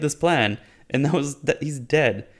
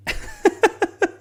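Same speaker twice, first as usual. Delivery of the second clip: high, staccato wheezy laugh; derisive giggle. Real, not acted. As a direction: giggle helplessly at the absurdity. Laughs at the fake cut.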